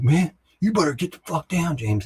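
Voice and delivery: high-pitched imitation of an opponent's warning; retold warning. Other sergeant warning James in a quarrel, imitated high-pitched retort.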